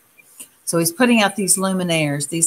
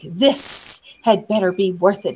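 cross hiss. A narrator's reading. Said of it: "he hissed, sounding cross".

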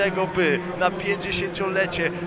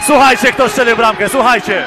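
high, loud call to the crowd; shouting; 6 semitones higher, 11 dB louder than usual. Real, not acted. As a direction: call out to the stands. Asks crowd who scored.

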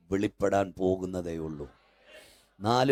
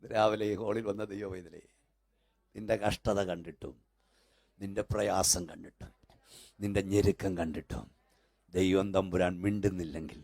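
softer, hushed telling of the tale; quiet storytelling; 5 dB quieter than usual. Acted. Preacher softly tells the theatre parable. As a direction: draw them in with a soft story.

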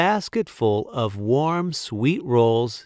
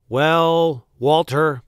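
louder, raised-pitch hesitant opening; deliberating Duke; 4 semitones higher, 5 dB louder, slower than usual. A narrator's reading. Duke reconsiders the baker's banishment, deliberating. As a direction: start a weighty announcement. Duke begins to relent, weighty.

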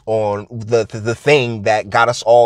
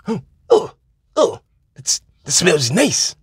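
mock entranced captive; 3 semitones higher than usual. Captive smelling her perfume.

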